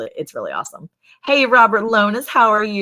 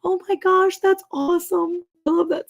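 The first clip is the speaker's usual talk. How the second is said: reading a viewer's story and squealing with joy; delighted gush; 11 semitones higher than usual. Real, not acted. Delight reading a chat comment.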